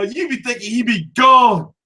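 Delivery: usual speaking voice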